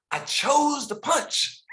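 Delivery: put-on high, slow, cheeky kid voice; kid's proud confession.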